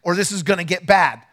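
high, loud shouted orders to calm a crowd; retold shout. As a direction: bark it like you are taking control of a crowd. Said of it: "grabbed her by the backpack and pulled her back and said".

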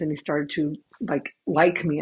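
ordinary talk, nothing marked

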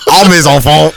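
high, loud fake French; mock French accent. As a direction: exclaim in a hammed-up French accent. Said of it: "my French is pretty good".

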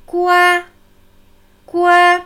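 "Gua" held high and steady, loud and even; level first tone. Shown as a demonstration. Teacher demonstrates the high level first tone of "Gua". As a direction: sing out "Gua" on one high flat pitch. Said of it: "Erster gleichmäßig Heulton".

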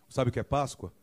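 ordinary talk

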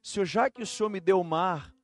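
loud, high preaching build; preaching at full force. Acted. Pastor telling his church the story, preaching loudly.

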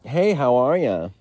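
high, slow cheery hello; perky waiter greeting. Pretend. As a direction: greet the table brightly.

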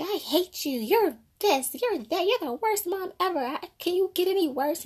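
put-on high whiny bratty teenager yelling at mom; mock a spoiled kid screaming at her mother; hammed-up high teen tantrum voice. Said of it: "they would say".

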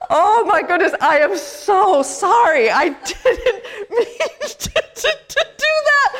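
high, loud, slowing apology through laughter; laughing apology. Retold or imitated. Pastor retelling her apology to Rolando, laughing. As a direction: apologize while cracking up. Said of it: "And I was like".

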